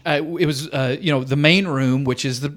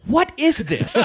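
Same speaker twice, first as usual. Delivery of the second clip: high-pitched imitation of bewildered onlookers; baffled crowd; 10 semitones higher, slower than usual. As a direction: ask up high in mock disbelief. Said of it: "crowd's looking at me like".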